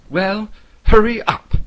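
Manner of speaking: high impatient hobbit call; relieved hobbit shooing him off; Bilbo hurrying. A narrator's reading. Bilbo urges Gollum to hurry, high and loud. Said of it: "said Bilbo, relieved".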